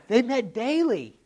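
"daily" stretched slow and loud at the end; stress on daily. Acted. Pastor hammering home to the church that they met daily, slow loud stress. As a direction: land hard on "daily". Repeats answer for emphasis.

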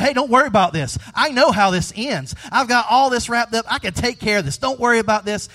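loud, upbeat, carefree reassurance; breezy brush-off. Pretend. Jesus imagined brushing off a grieving woman, breezy and loud. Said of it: "He could have said".

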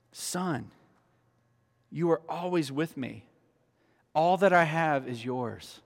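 soft, slow tender father. Retold or imitated. Father to older son, soft tender voice. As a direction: speak it gently like a loving dad. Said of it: "he said".

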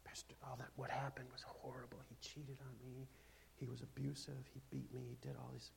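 soft, hushed, hurt plea; hushed, hurting ex-wife to pastor; hurt congregant. Pretend. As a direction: play a wounded wife confessing quietly. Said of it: "congregant's plea voiced".

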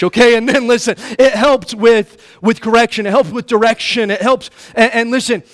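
loud, high preaching push; full-force preaching. Acted. Preacher pressing the point home to his congregation, loud and high.

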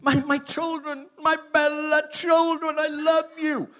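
high, slow and overjoyed; imitating Italian dad. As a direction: ham up the doting Italian dad. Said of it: "he's a part, he's been in it".

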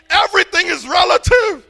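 preaching. Acted. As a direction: hammer the point about truth. Preaching on truth.

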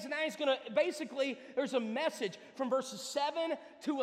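high, loud, driving sermon delivery; full-force preaching. Real, not acted. Preacher tells congregation to consider their ways, full-force preaching. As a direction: preach it hard and high to the whole room.

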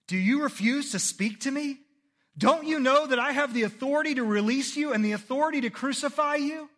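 high, loud demanding voice; indignant Pilate. A narrator's reading. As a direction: press him like an offended governor. Pilate threatening Jesus.

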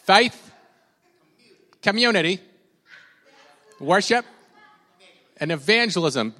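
mock Jersey accent, called out high and loud; playful Jersey accent, word by word. Shown as a demonstration. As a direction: lead a chant in a hammed-up Jersey accent. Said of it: "in your best Jersey accent".

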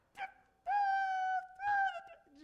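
very high, slow falsetto screech. Retold or imitated. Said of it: "just this high, screeching voice".

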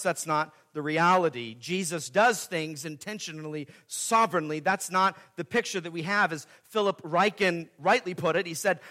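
normal talking voice

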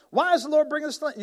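high, loud and fast whining; mocking complaint; mock whine. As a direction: rattle off the complaint like a grumbler. Voicing complaining Israelites.

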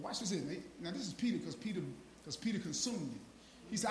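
ordinary talk

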